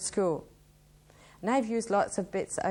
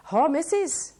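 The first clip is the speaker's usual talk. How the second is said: recited a bit higher and louder than her talk; poem recitation; 7 semitones higher, 6 dB louder than usual. Acted. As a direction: launch into the reply verse. Starts reciting the reply poem.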